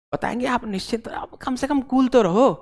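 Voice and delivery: whispered, playful aside to calm the student; teasing whisper. Real, not acted. Teacher whispers to an impatient student, teasing. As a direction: hush it like a private joke.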